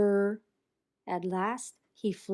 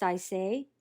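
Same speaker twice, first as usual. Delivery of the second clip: ordinary delivery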